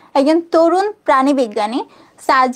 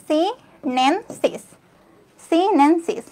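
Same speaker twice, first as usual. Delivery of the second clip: slow pronunciation demo; 3 dB quieter, much slower than usual. Shown as a demonstration.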